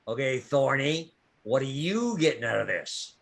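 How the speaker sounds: usual speaking voice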